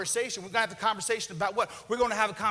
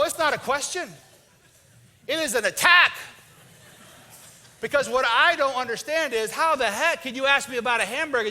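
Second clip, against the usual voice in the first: angry wife rant; 3 semitones higher, 3 dB louder than usual. Retold or imitated. Wife lets loose at husband over dinner question. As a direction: let it pour out as a wounded attack.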